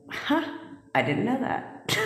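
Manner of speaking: mock-amazed discovery, light laugh at the end; surprised huh. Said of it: "But I was like".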